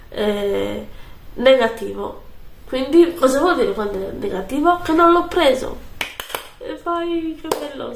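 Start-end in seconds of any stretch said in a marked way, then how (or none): 4.8-7.9 high, elated relief; excited. Real, not acted. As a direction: cheer with real relief. Relieved at negative test.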